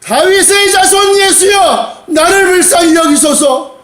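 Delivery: slow, high, full-force cry for mercy; desperate shout. Acted. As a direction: shout it out over the crowd. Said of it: "막 소리 지르니까". (절박하게 소리 지르며)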